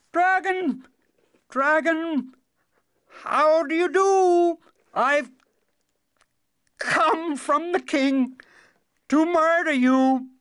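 high, loud, forced-brave call, slow in places; firm cry. Acted. Trembling son challenges the dragon, high forced-brave shout. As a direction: shout it out bravely though terrified. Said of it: "As loudly and firmly as he could manage, the youngest son cried".

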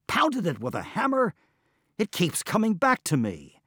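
high, loud, exasperated blurt. Acted. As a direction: throw the question back at him. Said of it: "blurted Mabon".

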